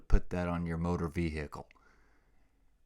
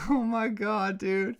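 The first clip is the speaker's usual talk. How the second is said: laughing; 6 dB louder, slower than usual. Real, not acted. Laughing at himself.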